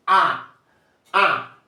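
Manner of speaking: "Ah" nasalised and set apart; nasal vowel demo. Shown as a demonstration. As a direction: model a nasal "Ah" for the class. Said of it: "I'm going to pronounce".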